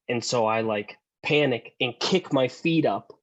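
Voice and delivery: ordinary talk, nothing marked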